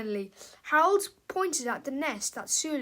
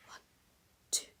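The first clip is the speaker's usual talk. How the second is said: whispered count-in; 4 semitones lower, 11 dB quieter, faster than usual. Acted. Narrator whispers the count-in before the taunting song.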